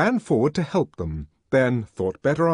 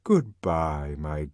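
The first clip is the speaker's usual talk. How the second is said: affectionate farewell; 6 semitones lower, much slower than usual. Acted. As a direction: send them off lovingly. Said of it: "richly affectionate baritone valedictions".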